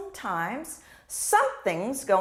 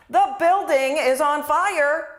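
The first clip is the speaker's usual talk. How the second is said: loud, higher urgent warning; urgent alarm; 6 semitones higher, 10 dB louder than usual. Shown as a demonstration. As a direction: raise the alarm. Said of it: "those are two totally different things".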